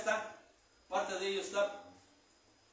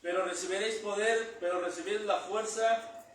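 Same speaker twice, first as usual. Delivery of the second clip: preaching at full force; 4 dB louder than usual. Acted. Preacher driving home the meaning of power, loud. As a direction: hammer each word home to the church.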